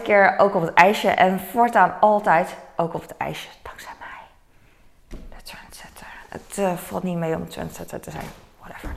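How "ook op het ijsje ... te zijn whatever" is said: soft, self-mocking whisper. Real, not acted. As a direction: whisper it like a secret boast. Mock-proud aside about trendsetting.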